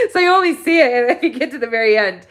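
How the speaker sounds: half laughing, half crying, voice lifted; real tearful laugh at the end of the year; laughing through tears. Real, not acted. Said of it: "before I started crying".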